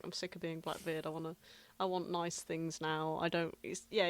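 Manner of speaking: ordinary talk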